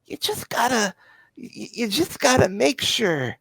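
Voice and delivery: high, coaxing con-man voice; wheedling schemer. Acted. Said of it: "the other one just replies".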